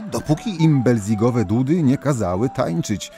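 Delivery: ordinary delivery, nothing marked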